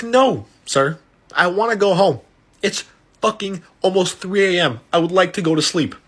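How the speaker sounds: mock-exasperated, insistent refusal. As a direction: plead to be let home, fed up. Retold exasperated refusal of the invitation.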